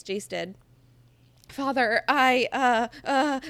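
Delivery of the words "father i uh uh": higher, louder flustered stammer; stammering son. Acted. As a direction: fumble for the words. Jace stammering apology.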